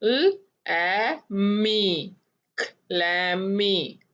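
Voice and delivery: Clammy drawn out slowly; slow blending. Shown as a demonstration. Phonics teacher modelling the word slowly for pupils. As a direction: stretch clammy syllable by syllable. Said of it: "आपने practice कर ली ना?".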